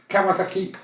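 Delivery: high-pitched Kawasaki, accent on KI; stress on last syllable. Shown as a demonstration. Contestant repeating the taxi call, Kawasaki stressed on its final syllable. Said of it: "en accentuant la dernière syllabe".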